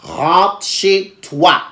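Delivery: very slow, word by word; slow, spelled-out order. Retold or imitated. Telling her date to leave, very slow emphatic order. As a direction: stretch each word with gaps between.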